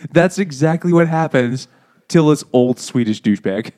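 ordinary talk, nothing marked

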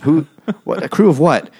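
high, loud mock bafflement; baffled nurse. Nurse asking Jeremy about the crew, high mock disbelief.

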